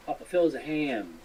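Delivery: ordinary talk, nothing marked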